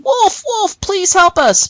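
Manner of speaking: high, acted cry for help; screamed plea. Boy seeing a real wolf, screaming for help. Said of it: "the boy screamed over and over again".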